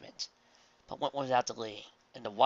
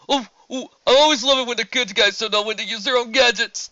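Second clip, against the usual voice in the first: much higher, louder gloating villain squeal; gleeful squeal; 11 semitones higher, 14 dB louder than usual. Acted. Villain Bruce Root mocking the hero, high gleeful squeal. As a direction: squeal with glee at the hero's blunder. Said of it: "squealed Bruce Root".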